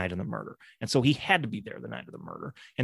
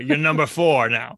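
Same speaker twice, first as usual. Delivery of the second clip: bailiff ordering; 3 semitones higher, 4 dB louder than usual. Retold or imitated.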